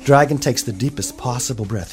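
ordinary delivery

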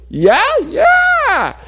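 very high, shouted eager squeal; excited agreement. Acted. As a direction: squeal with greedy delight. Greedy uncle thrilled by eating advice.

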